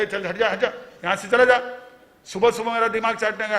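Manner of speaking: angry dismissal. Acted. Oilman chasing off a rude customer, angry and loud. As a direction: shoo him away in an acted irritated shout. Acted oilman chases the friend off.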